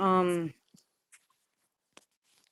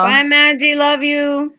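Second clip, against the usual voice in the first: calling out goodbye; 6 semitones higher, 7 dB louder than usual. Real, not acted. Calling goodbye to departing friend.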